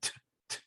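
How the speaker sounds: whispered "T, T" with the tongue tip at the front of the mouth; front T sound. Shown as a demonstration. Teacher demonstrates the front T to the class, whispered. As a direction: breathe out "T, T" crisply from the front teeth. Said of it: "the T sound in the front of the mouth".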